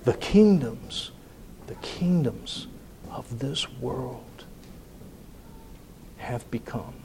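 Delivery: slow, hushed, fading almost to nothing; very quiet. Shown as a demonstration. Preacher echoing Handel's hushed passage, slow and very soft. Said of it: "Handel drops way down ... to get very quiet".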